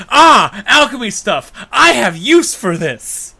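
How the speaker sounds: loud, high, slow delighted gasp; excited Melly. Retold or imitated. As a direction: gush over the alchemy stuff. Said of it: "And then literally".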